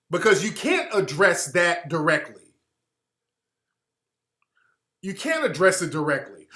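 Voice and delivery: usual speaking voice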